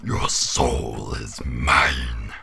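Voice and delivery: low, slow demonic whisper; Satan voice. Shown as a demonstration. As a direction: whisper it like Satan claiming souls. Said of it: "Satan in point one second".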